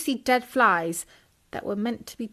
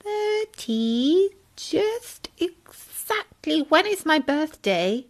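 put-on high, slow curious child voice; little Tim asking; 6 semitones higher, much slower than usual. Tadpole asking Bertie when his birthday is. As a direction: ask wide-eyed, drawing it out.

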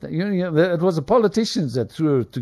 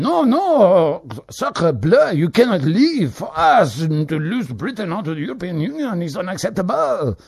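raised, louder hammed-up French-accented pleading; mock French protest; 8 semitones higher, 4 dB louder than usual. Europeans begging Britain not to leave, mock French protest. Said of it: "Instead of the Europeans saying".

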